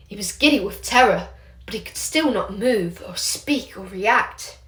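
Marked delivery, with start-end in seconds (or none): none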